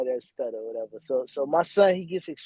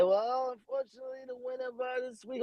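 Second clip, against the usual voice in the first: imitating judge; 8 semitones higher, 8 dB quieter than usual. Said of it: "The man declared".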